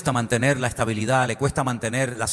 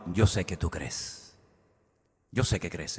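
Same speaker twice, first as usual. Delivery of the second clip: much lower, softer and slow; calm assurance; 10 semitones lower, 9 dB quieter, slower than usual. Acted. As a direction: speak it gently, as a calm certainty. Paul answers the king gently.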